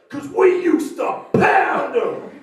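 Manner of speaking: very slow, high, loud yell; slow party bellow. Acted. Comic bellowing his catchphrase, slow loud yell. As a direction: drag out the catchphrase full volume.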